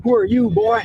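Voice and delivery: mock challenge rising at the end; suspicious local challenge. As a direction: imitate a local sizing up a stranger. Second version of locals' challenge.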